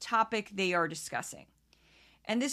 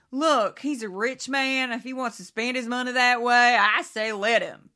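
mock blunt quote; 8 dB louder than usual. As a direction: play her as the no-nonsense talker, a touch hammed-up. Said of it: "She's like".

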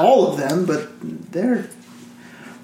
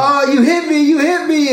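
fake victim; 9 semitones higher than usual. Fake accident victim complaining.

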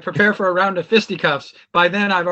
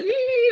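very high, thin "ee" sound; imitated squeal. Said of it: "and then there's like".

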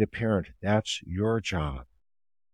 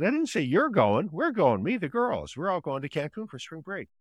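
higher mock teen voice, a touch of eye-roll; dismissive teen daughter; 9 semitones higher than usual. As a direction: imitate a teen correcting her mom.

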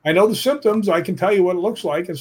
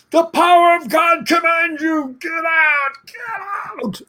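high, loud, forceful yell, slow on the command; retold commanding shout; 13 semitones higher, 3 dB louder, slower than usual. Donna ordering the dark spot out of her body, loud high yelling. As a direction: hurl the words like an exorcism, no holding back. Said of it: "she went for it".

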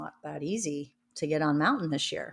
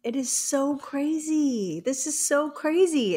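incredulous; 8 semitones higher than usual. Real, not acted. Shocked by UK travel ban.

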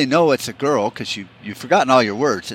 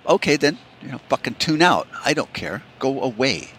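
usual speaking voice

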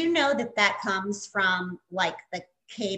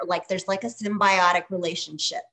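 ordinary talk

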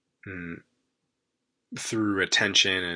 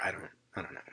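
unvoiced throwaway whisper; 2 semitones lower, 12 dB quieter, much faster than usual. Real, not acted. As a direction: drop to a whisper, unsure. Trailing aside, unsure.

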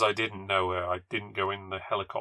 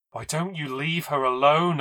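higher-pitched angry plea; angry cry; 6 semitones higher, 3 dB louder than usual. Acted. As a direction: cry out as anger suddenly rises. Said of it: "getting very angry ... I cried".

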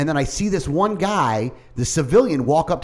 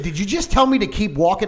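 indignant challenge; 6 semitones higher than usual. Retold or imitated. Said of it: "and I said".

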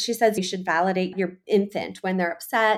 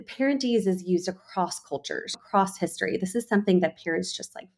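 ordinary talk

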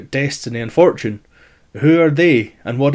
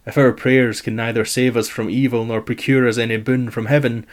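usual speaking voice

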